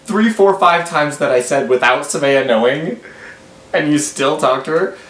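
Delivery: high, laughing disbelief; can't believe what you're hearing; high laughing disbelief at the dad; incredulous disbelief. Real, not acted.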